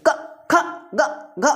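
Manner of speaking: each of क ख ग घ snapped out short with no vowel; teacher drilling half letters, clipped; short jerky letters. Shown as a demonstration. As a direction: jerk out क, ख, ग, घ quickly one by one. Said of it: "एकदम तुरंत बोलिए आप".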